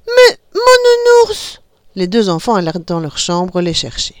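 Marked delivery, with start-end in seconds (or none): 0.1-1.6 put-on high, slow, loud little-girl voice; little girl cry. A narrator's reading. Little girl remembering her teddy bear, crying out. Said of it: "Petite Licorne cria".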